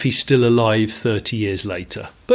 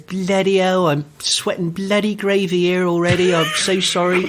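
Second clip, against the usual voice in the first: higher, flustered imitation of the portly instructor; sweaty instructor; 6 semitones higher than usual. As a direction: puff out the apology like he's melting in the heat. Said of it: "He goes".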